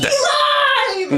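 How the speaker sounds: high, loud joyful cry of a name; excited shout. Acted. Player shouts NPC name excitedly.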